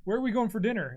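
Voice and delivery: high, loud imitated question; mock chat message. Player quoting the dinner plans from Discord chat, loud mock-eager. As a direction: call it out like an eager group text.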